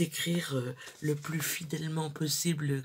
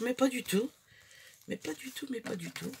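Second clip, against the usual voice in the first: playful high singsong; 8 semitones higher than usual. Real, not acted. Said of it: "denying a child sleeps, playful".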